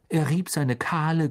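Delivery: ordinary delivery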